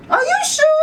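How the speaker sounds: put-on very high-pitched child's shout. Girl checks Ma's answer from afar, shouting. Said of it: "I shout out".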